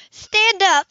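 "Stand up" with each word split apart, high and loud; each word separate. A narrator's reading. Nervous woman repeating his request, word by word. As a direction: space out "Stand" and "up" in alarm. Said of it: "she repeated each word separate way".

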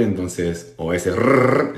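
usual speaking voice

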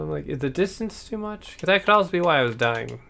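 ordinary delivery

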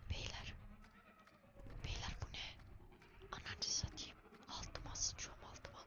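scared hushed whisper; whispering while hiding. Real, not acted. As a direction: whisper so the monster can't hear.

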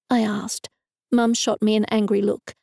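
ordinary delivery

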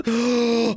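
big gasp after running out of air. Real, not acted. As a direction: gulp in air, winded. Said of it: "I'm out of breath".

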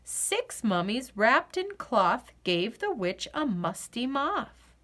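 ordinary delivery, nothing marked

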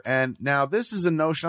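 ordinary talk

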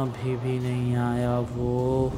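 very slow, soft, drawn-out moan; weary waiting. Acted. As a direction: drag every word out quietly, worn down by waiting. Still waiting, repeats it drawn out.

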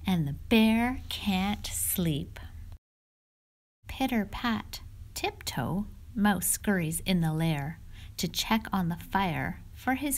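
cozy read-aloud storyteller voice; storybook read-aloud. Acted. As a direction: read it to the little ones like storytime. Reads picture book aloud.

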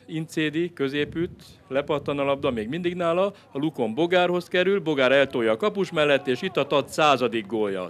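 loud, high live goal calls; excited hungarian sports commentary. Real, not acted. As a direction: call the goals like a thrilled commentator. Live goal commentary, louder, higher.

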